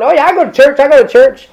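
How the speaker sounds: high, bright bragging voice; mock churchgoer. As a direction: chirp it like a smug churchgoer. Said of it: "People are going".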